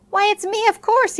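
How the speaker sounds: high-pitched, incredulous answer; surprised reply. A narrator's reading. Son answers his doubting father, surprised. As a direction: answer as if it were obvious, voice right up.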